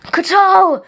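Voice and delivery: high, loud cry of grief; anguished yell. A narrator's reading. King screaming as his guard falls dead, anguished. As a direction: scream the fallen guard's name. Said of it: "Puramalum yelled".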